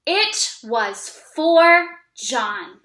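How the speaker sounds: slow, word by word reading; teacher models a sentence for kids to repeat, slowly. Shown as a demonstration. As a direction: read it slowly so they can copy. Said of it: "I'm gonna read it, and you're gonna repeat after me".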